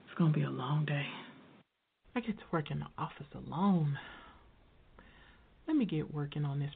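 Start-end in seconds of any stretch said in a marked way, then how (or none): 0.1-1.1 weary whisper. Real, not acted. Weary sigh arriving at work.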